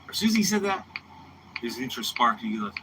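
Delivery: ordinary talk, nothing marked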